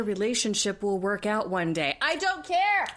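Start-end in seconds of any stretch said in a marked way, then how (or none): 2.0-2.9 high, emphatic I don't care; exasperated. Real, not acted. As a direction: fling it out, fed up. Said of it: "repeated declaration, high and loud".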